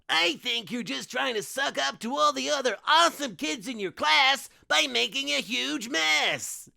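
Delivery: cheesy cat mascot, disapproving; cartoon mascot chiding. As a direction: chide like a kids' show hero. Cool Cat chiding Francis.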